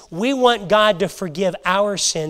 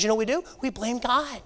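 ordinary talk, nothing marked

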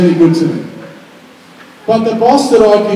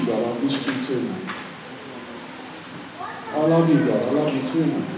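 soft, lower, intimate and tender; tender exchange; 6 semitones lower, 11 dB quieter than usual. Acted. A believer and God exchanging love, hushed and tender. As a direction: speak it like a quiet embrace.

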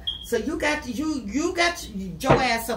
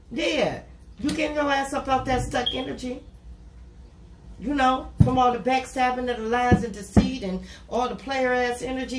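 ordinary talk

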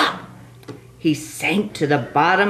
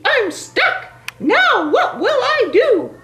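panicky turkey voice; 3 dB louder than usual. Acted.

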